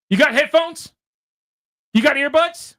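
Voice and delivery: high, loud demanding question; loud tough call. Retold or imitated. Tough guy demands earbuds from the phone watcher, loud and high.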